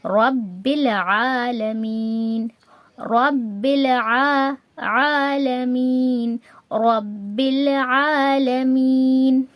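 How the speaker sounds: very slow chant, عین voiced from mid-throat in العالمین; عین in recitation. Shown as a demonstration. Teacher demonstrating عین from the middle throat, slowly recited. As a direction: hold the long vowels and make عین vibrate in the throat. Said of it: "example دیکھتے ہیں عین کا".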